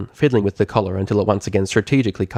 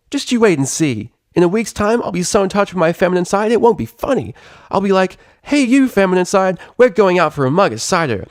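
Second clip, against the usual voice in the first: high, loud, cocky pony voice; brash tomboy pony; 9 semitones higher, 3 dB louder than usual. A narrator's reading. Rainbow Dash boasting to Octavia, high and cocky. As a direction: boast like a brash young flier.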